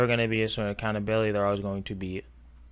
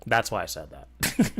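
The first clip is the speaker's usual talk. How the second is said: usual speaking voice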